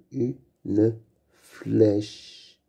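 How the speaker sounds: Une flèche split slowly into u-ne-flèche; syllable by syllable. Shown as a demonstration. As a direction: sound out "Une flèche" with clear gaps. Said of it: "On entend bien le son fle".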